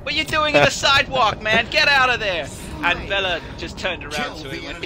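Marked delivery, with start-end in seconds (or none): 0.0-2.5 high, loud imitation of a street heckler; irate New Yorker. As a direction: yell like an annoyed New York passer-by. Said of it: "and he goes".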